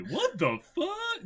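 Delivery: high, slow mock shock; audience reaction. Imitating the audience at the Pikachu scene, high. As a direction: gasp it like the stunned crowd. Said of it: "W T F from the people".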